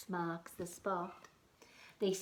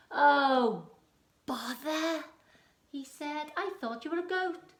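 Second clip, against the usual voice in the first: disappointed troll; 8 semitones higher, 9 dB louder than usual. Acted.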